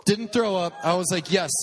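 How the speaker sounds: punchy, elated retelling; triumphant. Retold or imitated. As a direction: announce a small victory proudly. Celebrates not throwing up.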